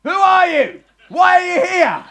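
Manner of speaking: high, loud cry with a laugh at the end; shouted morning call. Acted. [name] acting out the guard's daily wake-up shout. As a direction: shout it like the hired guard would.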